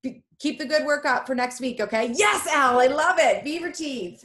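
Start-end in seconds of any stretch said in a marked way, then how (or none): 2.1-4.2 loud, high burst of enthusiasm; delighted praise. Real, not acted. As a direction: cheer the student on with delight. Delighted reaction to a student.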